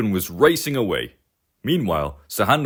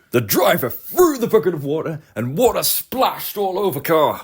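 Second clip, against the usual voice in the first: ordinary delivery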